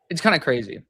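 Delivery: ordinary talk, nothing marked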